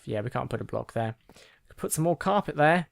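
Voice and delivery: usual speaking voice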